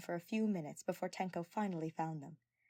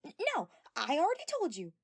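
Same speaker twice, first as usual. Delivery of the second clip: flustered, higher stammer; 9 semitones higher, 6 dB louder than usual. A narrator's reading. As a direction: trip over the first word in embarrassment. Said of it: "Mew stammered".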